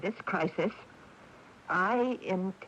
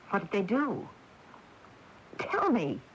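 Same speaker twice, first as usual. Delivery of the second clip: sharp, urgent demand at her sister; snapping; 4 semitones higher than usual. Acted. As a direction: snap the words out, impatient. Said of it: "no need to snap at me".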